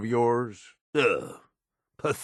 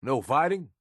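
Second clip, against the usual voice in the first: normal talking voice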